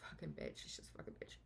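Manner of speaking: whispered under the breath, bitter; whispered insult. Real, not acted. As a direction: hiss it to yourself, barely audible.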